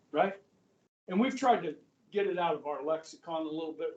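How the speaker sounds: ordinary delivery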